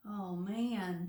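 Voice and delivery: plain reading voice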